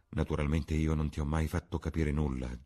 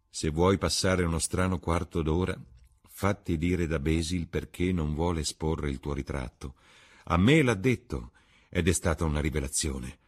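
plain reading voice

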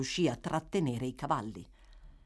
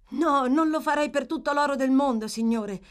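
high, loud, heartfelt denial; earnest protest; 8 semitones higher, 6 dB louder than usual. Acted. Boy swearing he would not deceive him, earnest. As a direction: insist with eager sincerity.

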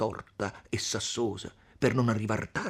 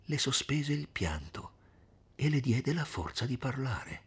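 ordinary delivery

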